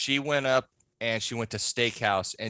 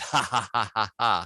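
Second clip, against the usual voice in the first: Freddy laugh; 2 semitones lower than usual. Retold or imitated. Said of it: "do the Freddy laugh".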